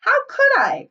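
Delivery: high, loud indignant yelp; indignant cry. A narrator's reading. Piggy hitting back at Ralph's rebuke, indignant high cry. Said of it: "cried Piggy indignantly".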